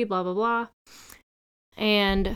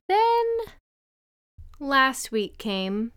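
high drawn-out then, slow suspense; dramatic buildup; 4 semitones higher, much slower than usual. Acted. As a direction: stretch it out for suspense. Builds up to embarrassing news.

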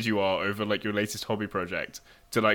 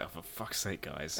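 muttered exasperation; 4 semitones lower, 14 dB quieter than usual. Retold or imitated. Quoting own exasperation at vaccine wait.

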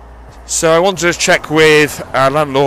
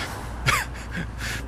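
soft laugh; 13 dB quieter than usual. Real, not acted. Laughing at own pedantry.

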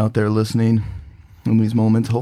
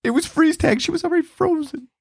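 put-on high kid voice.

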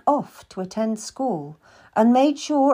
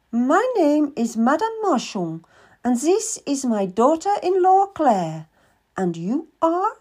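a much higher, brisk merchant woman's voice; Madame Marchand; 6 semitones higher than usual. Acted. Madame questions the boy seeking work, higher businesslike voice. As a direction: play a shrewd, businesslike older lady.

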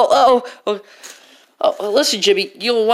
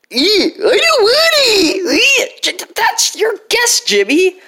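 high disgusted brat; whiny high ew; bratty kid voice; 11 semitones higher, 4 dB louder, slower than usual. Acted.

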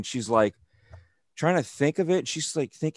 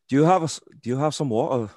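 a Scottish accent, a bit higher at first; bad Scottish accent; 3 semitones higher, much faster than usual. Shown as a demonstration. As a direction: ask for water in a Scottish accent. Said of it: "I have a very bad Scottish accent".

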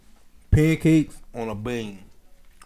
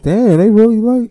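a higher, louder, stunned "damn"; amazed exclamation; 11 semitones higher, 7 dB louder than usual. Retold or imitated. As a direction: gush at how much food they keep bringing. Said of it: "I'm like".

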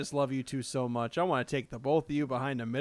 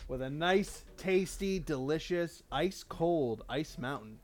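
ad read; 6 dB quieter than usual. Acted. Mock water advert.

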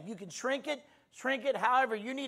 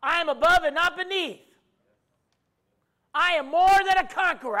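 preaching at full force; 8 semitones higher, 10 dB louder than usual. Acted. Preacher leading the congregation, full-force preaching. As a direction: proclaim each line like a battle cry.

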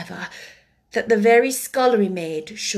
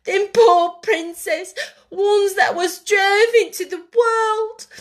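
high, slow, loud tearful wail; 12 semitones higher, 7 dB louder, slower than usual. A narrator's reading. Maid crying over her pots, wailing. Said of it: "she wailed".

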